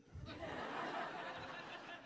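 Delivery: soft breathy laugh; quiet laugh. Real, not acted. Laughing at daughter's question.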